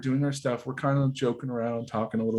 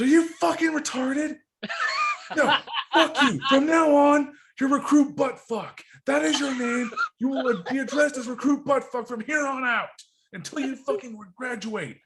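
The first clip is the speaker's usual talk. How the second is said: high-pitched, strained angry tirade; raging drill instructor; 12 semitones higher than usual. Acted. Drill instructor renaming a fumbling recruit, angry yelling. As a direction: shout it as furious orders, easing off at the end. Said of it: "drill instructor slams something in the office".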